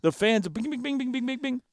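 ping out the bings like a pinball machine; pinball bing noises. Retold or imitated. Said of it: "it's a pinball machine of excitement".

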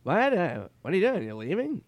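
high, loud baffled impression; Norm Macdonald. Norm arriving late at the bar, high baffled impression. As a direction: burst out high, then ease down. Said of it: "He goes".

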